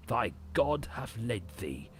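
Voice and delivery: ordinary delivery, nothing marked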